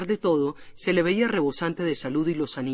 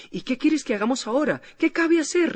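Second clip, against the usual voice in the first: helpless high outburst; 9 semitones higher than usual. A narrator's reading. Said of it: "casi sin saber lo que decía".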